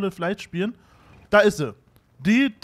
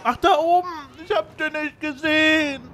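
high, louder surprised outburst; startled cry; 8 semitones higher, 4 dB louder than usual. Real, not acted. Gamer surprised by an enemy above, crying out high. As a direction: yelp as the hidden enemy hits you.

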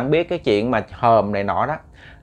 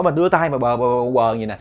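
ordinary delivery

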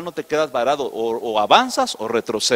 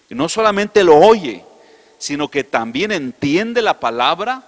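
loud pulpit proclamation, much louder; preaching at full force; 2 semitones higher, 8 dB louder than usual. Acted. Pastor proclaiming the parable of the sower, booming.

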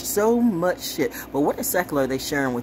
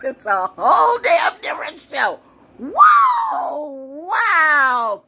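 excited shout; 11 semitones higher, 6 dB louder, much slower than usual. Real, not acted. Hyping her own psyop games.

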